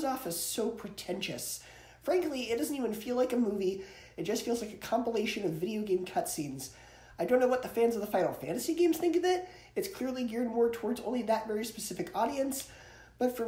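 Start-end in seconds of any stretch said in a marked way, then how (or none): none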